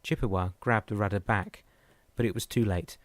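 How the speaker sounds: plain reading voice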